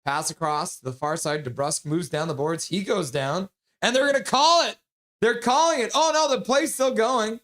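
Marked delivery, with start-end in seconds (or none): 3.8-7.4 higher and louder, calling the penalty as it happens; excited play-by-play. Real, not acted. Streamer calls the penalty live to viewers, excited. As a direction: shout it out as the refs seem to call it.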